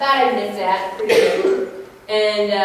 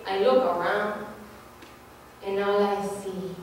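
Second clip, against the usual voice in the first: mock sultry; 5 semitones lower, 12 dB quieter, slower than usual. Imitating guy's romantic text.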